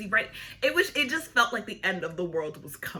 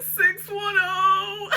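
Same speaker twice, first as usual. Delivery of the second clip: loud, high, drawn-out wail; imitating a crying kid reciting a number; mock bawling; 10 semitones higher, 7 dB louder, much slower than usual. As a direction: bawl out the digits in exaggerated sobs. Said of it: "bawling. She's like".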